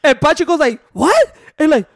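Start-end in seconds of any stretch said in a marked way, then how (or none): 0.9-1.4 high, questioning mock bewilderment; clueless confusion. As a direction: imitate a baffled friend, pitched up. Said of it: "still did not grasp what was happening".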